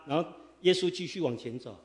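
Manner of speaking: ordinary talk, nothing marked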